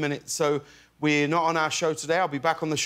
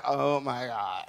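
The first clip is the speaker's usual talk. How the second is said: put-on low, slow, drawn-out Californian accent; Californian little girl; 5 semitones lower, 4 dB quieter, slower than usual. Dad imitating his five-year-old daughter, exaggerated Californian accent. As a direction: drawl it like a Californian, softer. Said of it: "she's the most Californian".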